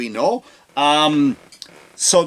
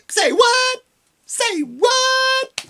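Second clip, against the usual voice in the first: very high squealed say what; mock sassy squeal; much slower than usual. Mocking Kamala's accent shift, squealing high. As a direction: shriek it in mock sass.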